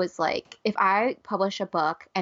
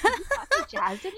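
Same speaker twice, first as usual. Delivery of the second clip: laughing; 14 semitones higher than usual. Real, not acted. Laughing teasing.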